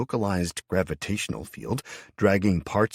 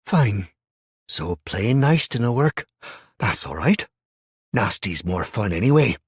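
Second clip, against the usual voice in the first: loud, menacing woman's snarl; 4 semitones higher, 7 dB louder than usual. A narrator's reading. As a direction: turn nasty, spit it out. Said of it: "she snarled".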